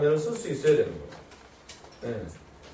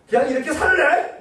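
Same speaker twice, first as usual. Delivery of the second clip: defiant retort; 10 semitones higher, 4 dB louder than usual. Retold or imitated. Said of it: "뭐 이렇게 나가는 겁니다".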